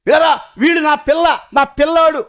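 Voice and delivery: shouted high and loud; preacher voicing a proud parent's shout; calling out. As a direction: call out like a parent.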